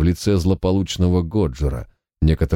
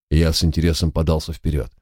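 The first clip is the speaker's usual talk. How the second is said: usual speaking voice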